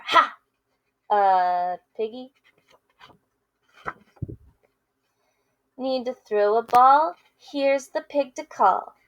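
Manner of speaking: lively picture-book read-aloud with character voices; reading to children. Acted. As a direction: read it to kids, playing both friends. Teacher reads picture book aloud.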